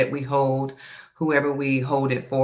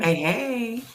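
sultry perfume ad; 10 semitones higher than usual. Acted. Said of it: "you're gonna have your sultry voice on today".

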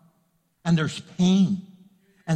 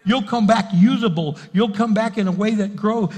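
preaching at full force; 3 semitones higher, 5 dB louder than usual. Acted. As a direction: build to a loud, soaring proclamation. Preaching climax over music.

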